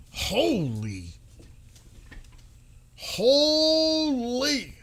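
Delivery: high, drawn-out astonished holy; stunned gasp. Real, not acted. Streamer reacting to a shocking post in astonishment. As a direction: let it hang in shock.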